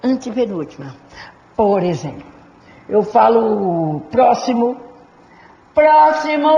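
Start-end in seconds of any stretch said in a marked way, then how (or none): 4.1-6.5 "próximo" slowly, PRÓ held long; stress on PRÓ. Shown as a demonstration. Teacher showing the tonic syllable, long PRÓ in "próximo". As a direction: stretch the first syllable of "próximo". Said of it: "sílaba tônica é o pró".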